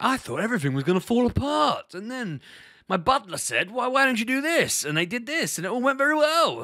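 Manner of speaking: very high, loud mock-gushing storyteller; hammed-up posh butler-owner testimonial; polished testimonial voice. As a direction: send up a polished person's success story in a high, loud put-on voice. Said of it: "really polished people going".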